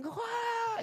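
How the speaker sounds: sudden high-pitched "Wah!" scream; hammed-up movie extra scream; mock scream. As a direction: shriek like fleeing a giant ape. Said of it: "You know, screaming".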